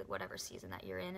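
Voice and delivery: ordinary delivery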